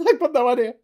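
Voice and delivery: high, quick, laughing admission; laughing bewilderment. Acted. Clueless audience member at live show, laughing bewilderment. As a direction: laugh through it, loud and clueless. Laughing at his own confusion.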